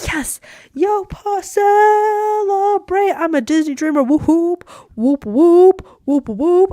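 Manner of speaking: high, loud whooping, hammed up; overjoyed celebration. Speaker reliving being accepted as a Disney dreamer, overjoyed. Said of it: "I was so happy".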